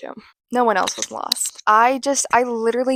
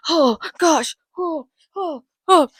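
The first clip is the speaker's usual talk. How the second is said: dramatic whimpers; 6 semitones higher, 4 dB louder, slower than usual. Retold or imitated. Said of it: "he's like".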